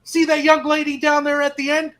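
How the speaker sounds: high, loud berating TI imitation; mock TI yell. Recruit imitates his TI berating him over his tray, loud and high. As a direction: chew him out like a drill instructor. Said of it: "He goes".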